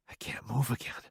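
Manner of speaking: frozen fearful whisper. Acted. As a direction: barely breathe the words. Frozen with fear.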